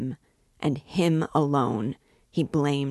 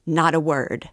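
louder, firm warning; commanding cry; 2 semitones higher, 6 dB louder than usual. Acted. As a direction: hold a friend back with a sharp order. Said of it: "cried Raskolnikov".

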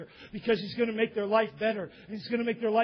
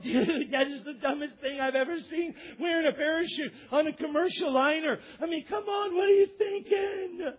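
mocking disbelief; 3 semitones higher than usual. Passengers ridicule man in parachute, mocking disbelief. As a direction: draw out the last question in mock amazement.

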